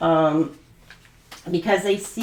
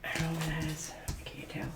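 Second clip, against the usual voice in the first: much softer, unvoiced aside; whispering; 5 semitones lower, 15 dB quieter than usual. Real, not acted. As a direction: whisper it to yourself. Mutters aside over card.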